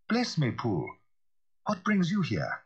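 surprised lawyer's call; startled, rising greeting; a lightly voiced startled Utterson; Utterson crying out. A narrator's reading. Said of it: "he cried".

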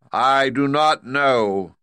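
loud, high, slow commanding giant-head voice; booming great head. Acted. Great head demanding payment from Dorothy, loud and stern. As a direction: lay down the law from the throne.

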